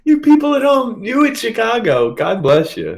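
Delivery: high, loud mock panic; squealing in pretend horror. Mock panic at being fired.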